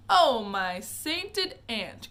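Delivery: loud, slow exclamation; cried out. Acted. Wonka recoils from cereal talk with a slow loud cry. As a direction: drag it out in outrage. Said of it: "cried Mister Wonka".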